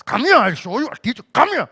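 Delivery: put-on loud, swooping threats to a child; hammed-up parental yelling; mock scolding father. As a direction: imitate an angry dad summoning the kids. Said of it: "It wasn't like us fathers".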